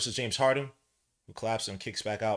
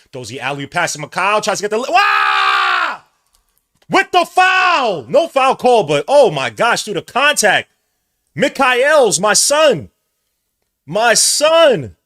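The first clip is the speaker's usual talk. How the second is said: much higher, much louder hype scream; hyped yelling; 12 semitones higher, 16 dB louder, slower than usual. Real, not acted. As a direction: scream with joy at the play. Hyped play-by-play on a big play.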